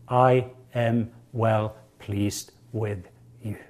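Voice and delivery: slow, soft, tender; tender fatherly assurance. Acted. God the Father softly assuring his beloved child. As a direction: speak it slowly and softly, like a loving father.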